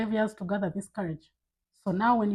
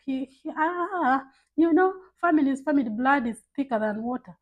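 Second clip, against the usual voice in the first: higher, exaggerated family-excuse voice; mock relative's excuse; 5 semitones higher, 5 dB louder than usual. Imitating relatives excusing bad behaviour to a viewer, mock pleading. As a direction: mimic a relative brushing it all off.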